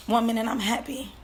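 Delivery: ordinary talk, nothing marked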